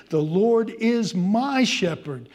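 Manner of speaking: each word weighed, slowly; slow emphatic scripture. Acted. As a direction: proclaim it slowly, every word heavy.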